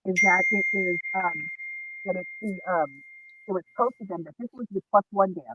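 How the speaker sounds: muffled, like driving into a tunnel; distant, cutting-out phone line. Real, not acted. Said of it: "You're muffled".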